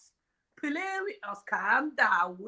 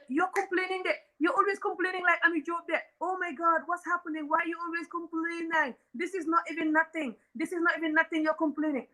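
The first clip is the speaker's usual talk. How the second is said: put-on dismissive boyfriend; 6 semitones higher than usual. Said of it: "Don't just tell".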